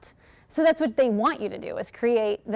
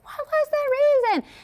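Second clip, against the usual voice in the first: much higher, slow hammed-up yell into a phone; mock phone scream; 14 semitones higher than usual. Remaking the realtor Reel for brides, hammed-up yell. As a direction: replay the realtor's screaming bit. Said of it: "I did the exact same thing".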